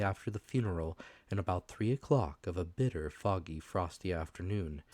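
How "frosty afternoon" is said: ordinary delivery, nothing marked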